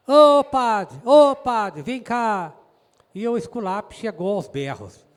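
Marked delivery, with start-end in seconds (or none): 0.1-2.5 loud, high call to the priest; imitated shout. Doctor calling the priest out at dawn, shouting. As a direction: holler it out, drawn from the doctor's bellowing. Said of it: "começa a gritar".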